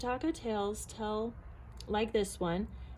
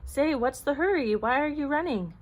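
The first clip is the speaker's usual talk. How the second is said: higher, louder calling question; curious rabbit; 5 semitones higher, 5 dB louder than usual. A narrator's reading. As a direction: call after a runner. Second rabbit calls to passing runner.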